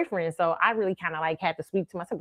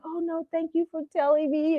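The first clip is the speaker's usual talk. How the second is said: high, small, tearful thanks; high tearful girl voice; 10 semitones higher, 4 dB quieter than usual. Retold or imitated. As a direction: thank her through tears. Crying girl thanking her.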